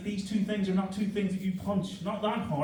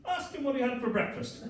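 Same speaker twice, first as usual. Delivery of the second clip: wife's tease; 7 semitones higher than usual. Retold or imitated. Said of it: "she just went".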